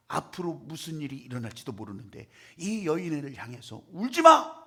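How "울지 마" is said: louder, higher firm don't cry; firm command. Acted. Jesus to the widow, firm loud command. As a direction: command it with force.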